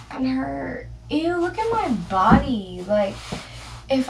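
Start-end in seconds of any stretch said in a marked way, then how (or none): none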